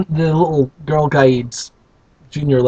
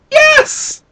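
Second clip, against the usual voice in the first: very high tiny "Yes!"; squeaky shout. Acted. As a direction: shout yes like a gang of elves. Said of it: "they shouted".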